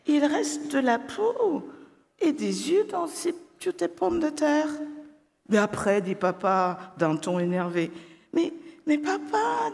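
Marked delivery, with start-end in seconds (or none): 0.1-4.7 put-on high childish reproach; girl's voice. A narrator's reading. Sophie points at dad's potatoes. 8.3-9.7 put-on very high pleading child; girl's voice. A narrator's reading. Sophie answers annoyed dad.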